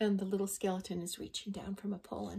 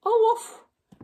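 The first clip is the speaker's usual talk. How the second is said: much higher, louder silly woof; a squeaky confused bark; dog skeleton; 12 dB louder than usual. Acted. Jumbled dog skeleton barks, much higher and louder.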